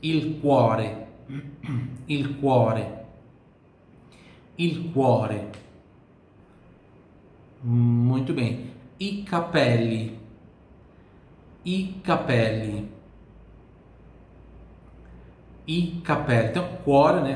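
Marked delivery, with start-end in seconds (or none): 0.0-5.5 il cuore, repeated slowly for practice; teacher repeats il cuore for learners; Italian repetition. Shown as a demonstration. Teacher repeats il cuore slowly. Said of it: "Sempre repetindo em voz alta". 9.0-16.5 i capelli, slow and clear; teacher drills i capelli slowly; slow model word. Shown as a demonstration. Teacher drills i capelli slowly for learners. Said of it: "Sempre repetindo em voz alta".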